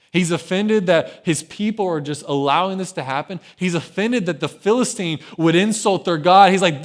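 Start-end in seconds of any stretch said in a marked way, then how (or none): none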